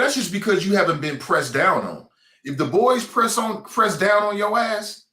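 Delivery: usual speaking voice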